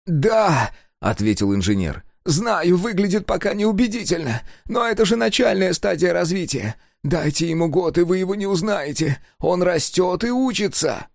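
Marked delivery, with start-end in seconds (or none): 0.1-0.7 high, eager little man's voice; excited old engineer. A narrator's reading. Engineer gloating at Sega, high and eager. 2.2-11.1 high, eager little man's voice; excited old engineer. A narrator's reading. Engineer gloating at Sega, high and eager.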